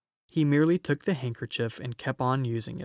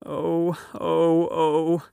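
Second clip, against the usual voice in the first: loud, higher acted wailing sobs; sobbing; 4 semitones higher, 5 dB louder, much slower than usual. A narrator's reading. Mister Tumnus breaks down before Lucy, sobbing loudly. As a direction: wail each oh as a sob. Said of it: "sobbed Mister Tumnus".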